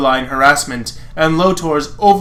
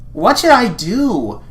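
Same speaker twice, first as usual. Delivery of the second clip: musing heroine; 7 semitones higher, slower than usual. Acted. Reader voices heroine.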